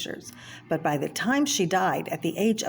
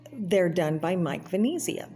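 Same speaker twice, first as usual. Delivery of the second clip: usual speaking voice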